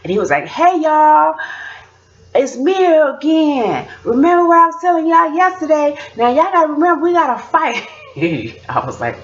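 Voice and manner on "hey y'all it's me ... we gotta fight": put-on high, loud kid preacher; high-pitched mock sermon holler; preaching child. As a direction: imitate a boy calling out to his congregation. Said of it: "he started preaching again".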